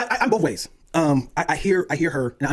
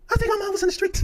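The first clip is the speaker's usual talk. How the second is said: comic realization. Real, not acted. Comic punchline.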